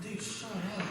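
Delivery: hushed retort. Retold or imitated. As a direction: imitate hushing a friend under your breath. Pitcher hushing his heckling friend.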